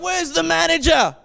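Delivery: high, demanding mock shout; re-enacted angry customer; irate dad. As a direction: act out a father storming in to complain. Angry dad confronts staff in story.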